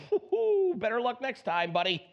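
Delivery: perky self-encouragement to your reflection; pep talk in the mirror. Retold or imitated. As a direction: cheer yourself on like a buddy. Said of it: "this is me in the morning talking to myself in the mirror".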